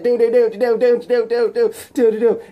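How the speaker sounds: high, loud rapid-fire "do do do"; machine-gun volley of mock punch noises; fight sound effects. Voicing rapid fight blows.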